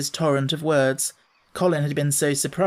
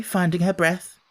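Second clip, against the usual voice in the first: ordinary delivery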